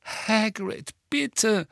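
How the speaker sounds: high, pleading appeal; desperate plea. A narrator's reading. Hermine pleads with Hagrid, truly desperate. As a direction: beg him, pitched right up. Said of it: "echte Verzweiflung lag in ihrer Stimme".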